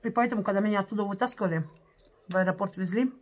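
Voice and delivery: chewing while talking; speaking around food; mouth full of rice. Real, not acted. Seen 0: Bite of rice at -2; lips and cheeks look full while speaking.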